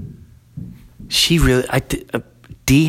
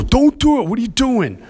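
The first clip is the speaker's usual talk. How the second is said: put-on kids' protest; 12 semitones higher than usual. Father imitating the kids stopping him, high mock protest. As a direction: squeak it like the kids stopping you. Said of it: "and they go".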